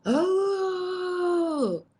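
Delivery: high, long drawn-out imitated holler from the woods; imitated yell. Retelling the yell heard from the woods, mock holler. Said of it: "just as loud as they possibly could, yelled out".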